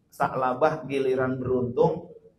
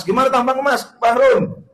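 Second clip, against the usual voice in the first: loud, higher, stern angel questioning; 10 semitones higher, 9 dB louder than usual. Acted. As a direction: demand answers like an interrogating angel.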